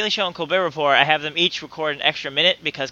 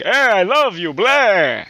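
louder, hammed-up goofy voice; silly put-on voice; 4 semitones higher, 5 dB louder, much slower than usual. Friend showing how the wife could say it, silly voice. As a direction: ham it up in a silly accent. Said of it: "she won't go like".

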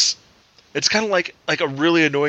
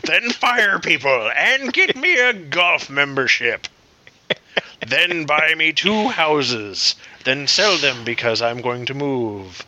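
unhinged villain; slower than usual. Pretend. Continuing greedy CEO act.

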